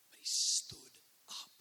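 slow, high, breathy whisper; dramatic whisper. Real, not acted. Preacher delivering the climax of the story, slow hushed whisper. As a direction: let it land as a hushed climax.

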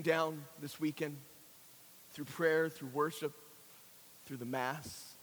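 low, soft invitation with long pauses; hushed preaching. Real, not acted. Preacher inviting listeners to reconciliation, hushed preaching. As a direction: bring the sermon down to a quiet, intimate appeal.

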